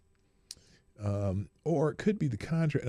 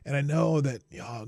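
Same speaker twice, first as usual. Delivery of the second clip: ordinary talk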